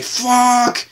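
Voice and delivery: high, drawn-out mock yell of disbelief; re-enacted yell at the screen. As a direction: relive yelling at the TV in amazement. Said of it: "I was just yelling at the screen like".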